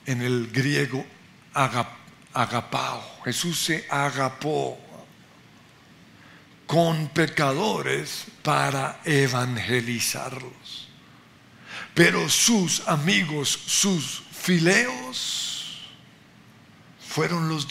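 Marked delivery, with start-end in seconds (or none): 1.5-3.2 "agapao" said carefully, syllable by syllable, a-ga-pa-o; Greek word agapao. Shown as a demonstration. Preacher teaching the congregation a Greek word, careful pronunciation. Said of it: "aquí enfatizo la palabra en el griego". 13.7-15.8 "fileos" drawn out very slowly and set apart; stress on fileos. Shown as a demonstration. Preacher explaining the Greek word for friends, slow stress. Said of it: "enfatizo la palabra".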